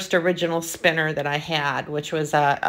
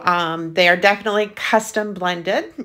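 ordinary talk

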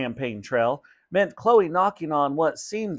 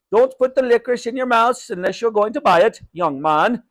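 loud, higher scolding shout; 9 semitones higher, 6 dB louder than usual. Acted. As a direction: shout across the shop at the boys. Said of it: "shouted over at some boys".

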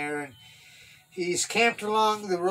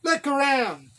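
high, raised call; 9 semitones higher, 4 dB louder than usual. A narrator's reading. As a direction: order them from across the valley. David still shouting to the camp.

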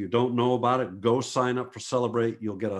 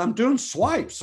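higher and louder, slowed right down; proud matter-of-fact answer; 12 semitones higher, 5 dB louder, slower than usual. Retold or imitated. Photographer answering a short stranger, slow proud reply. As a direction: say it like it's obvious and clever. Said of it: "And I said".

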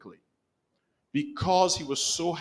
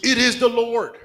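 higher, louder joyful cry; excited recognition; 8 semitones higher, 6 dB louder than usual. Retold or imitated. John calling to Peter on the boat, excited. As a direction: announce it with sudden joy.

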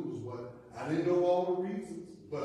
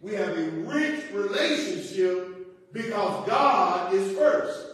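loud, raised preaching voice; forceful preaching; 6 semitones higher, 9 dB louder than usual. Real, not acted. Pastor teaching the congregation to put God first, forceful preaching. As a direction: drive the point home like a preacher.

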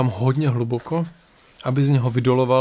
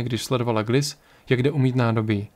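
ordinary delivery

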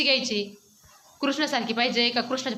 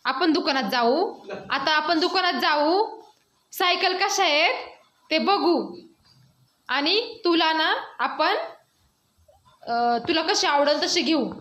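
slow coaxing; 2 semitones higher, slower than usual. Real, not acted. Parent promising a crying child a trip to the shop, slow coaxing. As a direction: spell out the plan slowly, phrase by phrase.

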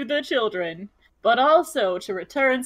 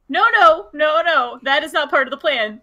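emphatic no; 3 semitones higher, 7 dB louder than usual. Acted. Heated in-character refusal.

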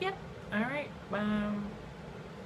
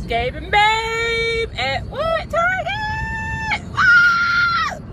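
high, loud building shout; rising hype, shout the reveal; excited shout; 10 dB louder, much slower than usual. Real, not acted. Announcing to viewers where she meets them, hyped shouting.

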